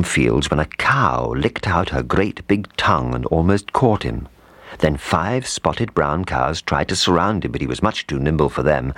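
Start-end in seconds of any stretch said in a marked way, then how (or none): none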